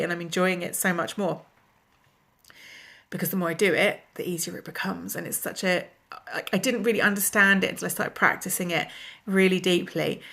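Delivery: ordinary talk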